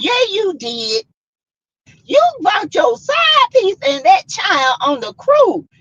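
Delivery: high, shouted accusation; angry wife. Retold or imitated. Wife yelling at husband about the side piece: high shout.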